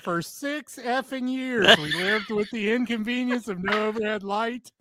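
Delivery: high, hammed-up indignant rant; performed fuming disbelief; comic exasperation. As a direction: rant it in mock outrage, emphasizing the grievance. Reads the poster's fuming aftermath.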